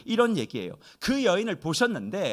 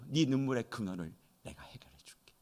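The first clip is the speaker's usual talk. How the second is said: whisper; 5 semitones lower, 9 dB quieter than usual. Acted. Voicing Jesus's promise.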